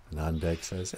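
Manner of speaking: plain reading voice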